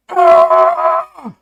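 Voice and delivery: very high, loud strangled shriek; choked scream. Retold or imitated. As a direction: squeal like someone being choked. Imitating choked woman's cry.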